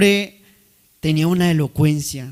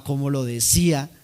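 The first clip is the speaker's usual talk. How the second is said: ordinary talk, nothing marked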